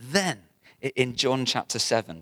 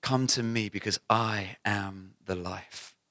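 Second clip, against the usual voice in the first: softer, slow tender invitation; 3 semitones lower, 5 dB quieter, slower than usual. Acted. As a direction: draw them in gently and slowly.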